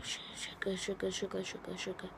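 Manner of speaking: nervous whisper under breath; hushed, tense whisper. Real, not acted. Nervous whisper near bee.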